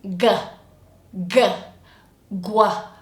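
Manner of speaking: "G" as a hard guh, then "gua"; isolated hard "g", then blended into "gua"; back-of-tongue g. Shown as a demonstration. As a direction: hit "G" with back-tongue contact. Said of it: "making contact in the back like this".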